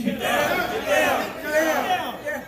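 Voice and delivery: high, loud shouted commands; yelling. Acted. Security team ordering the congregation down, high loud shouting. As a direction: bark it high and loud like security taking charge. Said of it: "you're gonna hear them just yell".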